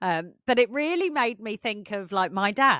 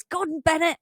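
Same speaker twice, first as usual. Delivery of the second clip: lightly mimicked dad, pitched up; mock exasperation in a higher voice; dad's exasperated catchphrase; 9 semitones higher than usual. Daughter quoting dad instead of swearing, mock exasperation. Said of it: "he always just goes".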